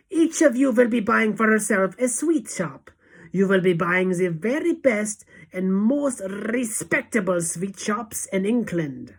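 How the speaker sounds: high, loud orders, easing at the end; commanding witch. A narrator's reading. Witch leader instructing her followers, loud commanding voice. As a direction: proclaim it, slowing on the last words.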